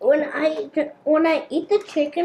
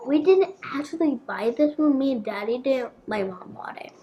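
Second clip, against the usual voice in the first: usual speaking voice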